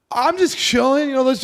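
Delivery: mock high nervous chill. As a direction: squeak out an excuse. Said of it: "I'm like".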